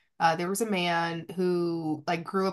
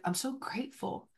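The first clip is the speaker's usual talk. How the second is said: much softer, higher, breathy awe; a soft, moved whisper of thanks; hushed gratitude; 6 semitones higher, 10 dB quieter than usual. Retold or imitated. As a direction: murmur it as if overwhelmed. Recalling mushroom ceremony gratitude.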